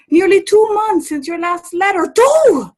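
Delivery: high, loud rising indignation; exasperated scolding. Acted. Mother scolding her son over his letters, rising exasperation. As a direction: scold him for not writing.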